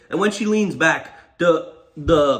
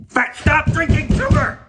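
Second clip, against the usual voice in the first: high, loud scolding outburst; exasperated yell; 12 semitones higher, 6 dB louder than usual. Acted. Storyteller scolding the passenger over his Coke, exasperated yell. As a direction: yell it at him in high-pitched disbelief.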